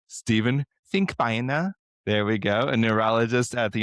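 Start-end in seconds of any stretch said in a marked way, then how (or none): 0.1-1.7 German-accented name, pitched higher; name pronounced the German way, ending in an open "ah". Shown as a demonstration. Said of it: "say it with the German accent".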